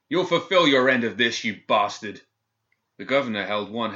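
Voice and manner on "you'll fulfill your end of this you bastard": loud furious demand; angry shout. A narrator's reading. Prisoner shouting his demand at the governor. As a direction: spit it at him.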